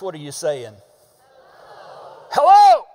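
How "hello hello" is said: loud, high-pitched bellowed HELLO; all-caps shout. Shown as a demonstration. Preacher demonstrates all caps to congregation, shouting. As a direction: yell it like all-caps text. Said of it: "if you put it in all caps".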